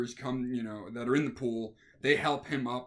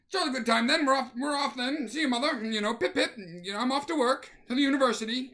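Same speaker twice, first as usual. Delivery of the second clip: much higher, chirpy pip-pip gentleman voice; mock proper gentleman; 10 semitones higher, 4 dB louder, faster than usual. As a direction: play a prim businessman off to work. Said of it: "in a suit with a briefcase".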